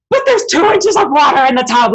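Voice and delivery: loud, high, whiny mock complaint; hammed-up petty spoiler of joy; mocking parasites. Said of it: "And they're like".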